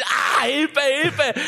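high, half-voiced mock cry for help; retold scream for help. Coaster rider retells fearing for his life, mock screamed plea. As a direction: imitate screaming for your life without full volume. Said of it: "Ich hab geschrien".

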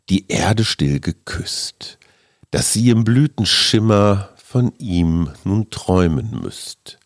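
slow, hushed poem reading; poem recitation. Acted.

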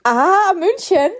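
surprised. Real, not acted. Guest says he is in Munich.

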